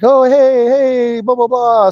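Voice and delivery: high, sing-out mock greeting; imitated chatty greeters. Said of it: "everybody else is like".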